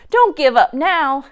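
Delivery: loud urgent plea; pleading shout. A narrator's reading. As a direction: beg them to keep fighting. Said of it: "screamed Joe".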